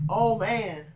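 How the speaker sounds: a loud, fed-up "Oh, man"; exasperated groan. Retold or imitated. Friend breaks his mirror again, loud exasperated groan. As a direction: sigh it out like the mirror broke twice. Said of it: "I'm like".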